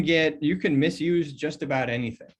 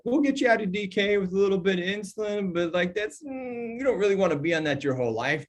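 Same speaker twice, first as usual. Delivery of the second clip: put-on New York accent; 3 semitones higher than usual. Doctor brushing off a diabetic patient, mock New York accent. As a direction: ham up a New York doc talking down to a diabetic. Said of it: "I don't know why I'm all New York right now".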